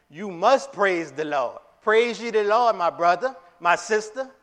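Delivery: loud, high commanding call. Retold or imitated. David commanding the people of Israel, loud. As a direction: order the people to praise. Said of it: "with a, a command".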